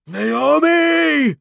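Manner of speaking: high, long drawn-out roar of a name; bellow. A narrator's reading. Burning vampire calling for Naomi, bellowing. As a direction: bellow it out across a crowd. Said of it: "he bellowed".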